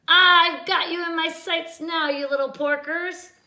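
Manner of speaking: high, loud cackling witch; loud, high witch taunting the pigs. A narrator's reading. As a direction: gloat like a witch eyeing her prey. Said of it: "she cackled".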